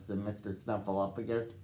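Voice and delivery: plain reading voice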